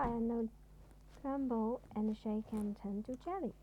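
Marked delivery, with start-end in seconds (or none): none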